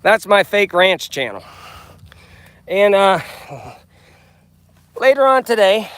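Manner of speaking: loud rancher, mild west Texas twang; grumpy grandpa voice. Pretend. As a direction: boom it out like a ranch host. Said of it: "I'm acting like I'm on Grumpy Grandpa Patriot Show".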